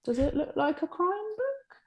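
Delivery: high, slow, doubtful sceptic voice; a sceptical sales head frowning over a cover. Retold or imitated. Sales head doubting the cover, in a high, slow voice. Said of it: "sales will just shake their head and go".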